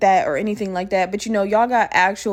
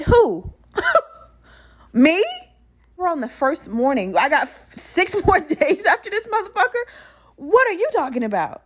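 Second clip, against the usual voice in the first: high-pitched, laughing disbelief; hammed-up indignant protest, voice leaping up; incredulous; 8 semitones higher than usual. Patient told she has six more days, incredulous.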